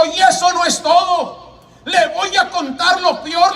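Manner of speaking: high, insistent voice of a troubled man; agitated confessor. Retold or imitated. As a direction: press the confession on the listener, pitched high. Agitated killer confesses to preacher.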